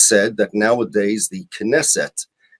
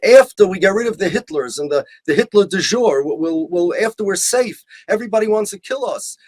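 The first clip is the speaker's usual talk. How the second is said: high-pitched, imitated siege mentality; mock alarm; 7 semitones higher than usual. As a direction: imitate someone putting everything off out of alarm. Voicing Zionists' anxious excuses.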